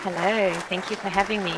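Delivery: natural South African accent. Real, not acted. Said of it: "There's the real accent".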